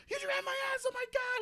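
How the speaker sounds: put-on high shrieking yell of an offended girl; mock girl's yell. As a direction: shriek it high like the outraged sister. Sister yelling at the party.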